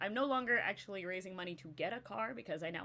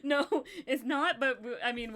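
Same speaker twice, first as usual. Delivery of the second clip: higher and louder, laughing through the words; words tumbling out between laughs; laughing excitedly; 6 semitones higher, 7 dB louder than usual. Real, not acted. Laughing excitedly about the prompt.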